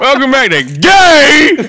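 high, loud video-host shout; shouted mock intro. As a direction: belt out a parody intro, last word held.

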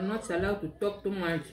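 chewing while talking; eating with hands; mouth full. Real, not acted. Seen 2: Eating by hand; mouth moving around food, hand at lips just before.

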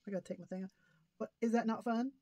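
ordinary talk, nothing marked

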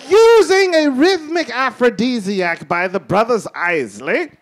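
plummy documentary host, amused; nature documentary. Acted. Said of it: "My name is Sir Reginald Holoferlum".

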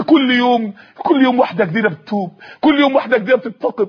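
ordinary delivery

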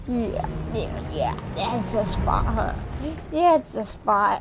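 hushed murmur while scratching; soft petting murmur. Real, not acted. Owner murmuring while scratching the dog's spot. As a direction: murmur softly to the dog.

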